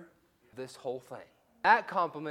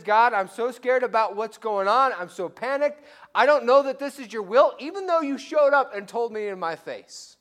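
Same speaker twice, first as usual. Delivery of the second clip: loud, high, panicked plea to God; scared pleading Gideon; 6 semitones higher, 10 dB louder than usual. Acted. Gideon begging God for a sign, loud and panicked. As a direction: beg God in a frightened rush.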